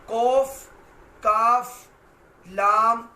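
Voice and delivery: each letter called out loud and held; loud, careful recitation of letter names; clear letter demonstration. Shown as a demonstration. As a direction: announce every letter loudly for the class. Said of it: "इनमें दरमियान में अलिफ मद्दा है".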